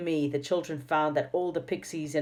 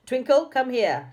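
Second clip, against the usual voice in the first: a sharp call, much higher and louder; snapped; 10 semitones higher, 6 dB louder than usual. A narrator's reading. Dame Snap summoning a pixie to her, snapping. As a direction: snap the name like a stern schoolmistress. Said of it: "she snapped".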